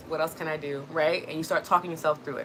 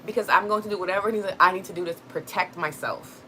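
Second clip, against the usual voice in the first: normal talking voice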